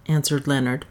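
plain reading voice

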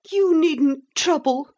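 high, sulky common woman's voice; a resentful servant girl pleading her case; Pretenderette's voice. A narrator's reading. Pretenderette voice held.

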